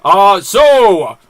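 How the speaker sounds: alien villain. Acted. Host playing the Simpsons alien villain, loud menacing boom. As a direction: sneer down at puny humans.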